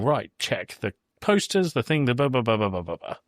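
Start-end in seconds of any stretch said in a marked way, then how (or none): none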